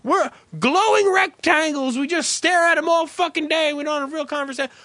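high, mocking preachy voice; whiny lecturing scold. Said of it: "I'm not gonna be the guy who says".

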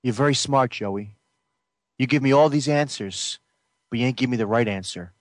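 low, controlled, threatening; low simmering menace. Acted. Jealous brother coldly presses for the truth. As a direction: keep it low and dangerous. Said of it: "End scene".

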